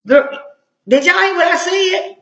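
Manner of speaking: can you believe this; incredulous. Real, not acted. Incredulous at the quoted text.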